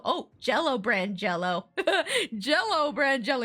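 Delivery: giggling through the words; amused giggly repetition; laughing. Real, not acted. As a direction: laugh at the joke. Reader laughing at own joke.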